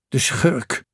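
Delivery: mildly scornful mutter of contempt; understated sneer. A narrator's reading. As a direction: say it with a touch of scorn. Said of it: "schamperde hij".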